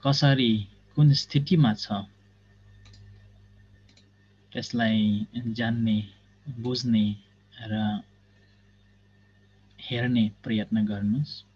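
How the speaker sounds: measured instructions with long silences; guided meditation. Real, not acted. Teacher guiding students to observe the body, guided meditation. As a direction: guide them step by step, pausing between phrases.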